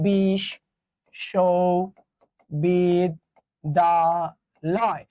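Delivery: বিশ্ববিদ্যালয় very slow, five long separated syllables; syllable-by-syllable spelling. Shown as a demonstration. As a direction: draw out bish, sho, bid, da, loy. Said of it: "এরকম লেখো না".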